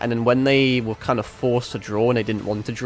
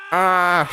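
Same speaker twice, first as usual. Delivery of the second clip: short hurt yelp, higher; pained cry; 7 semitones higher, 4 dB louder than usual. Acted. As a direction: cry out as if struck. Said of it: "Let me get back into voice acting mode".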